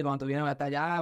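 ordinary talk

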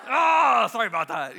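loud wail dropping into apology; mock panicked apology. Man caught thinking badly before Jesus, mock panicked apology. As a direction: cry out, then apologize, hammed-up. Acted apology for bad thought.

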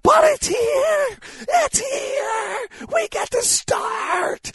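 high, loud, stretched-out cheer; excited shout. Real, not acted. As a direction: shout it out with joy. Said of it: "I don't wanna yell too loud".

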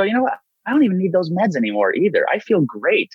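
high, breezy overconfident voice; carefree self. Retold or imitated. Man mocking his past self quitting meds, high and breezy. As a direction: sing it out, sure you're cured. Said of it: "And you go".

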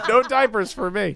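laughing through the words; said while cracking up. Real, not acted. Joking about diapers, laughing.

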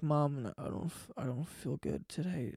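faint, whispered fake-sick plea; barely voiced, pitiful sick voice; faking frailty. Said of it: "I would fake sick".

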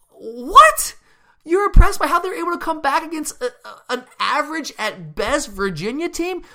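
high, loud disbelieving "What?"; incredulous outburst. Real, not acted. Host mocking the playoff committee's reasoning in loud, high disbelief.

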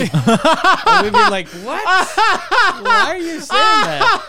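high-pitched laughing disbelief; cracking up mid-question. Real, not acted. Laughing while telling story.

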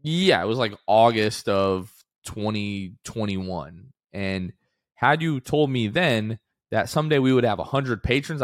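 ordinary talk, nothing marked

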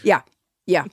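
normal talking voice

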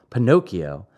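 ordinary delivery, nothing marked

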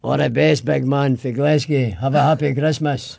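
broad Glasgow accent, matter-of-fact; Glaswegian. Shown as a demonstration. Scot sending a big man Christmas wishes, Glaswegian. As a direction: give it in thick Glaswegian. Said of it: "Say that again in Glaswegian".

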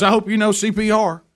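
mock neighbor. Neighbor joking after his fright.

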